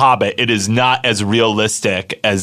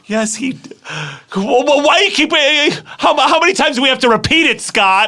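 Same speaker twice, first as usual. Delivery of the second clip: shrill-free but pitched way up, fed up with being doubted; indignant outburst; 10 semitones higher than usual. Acted. Outraged comedic overreaction.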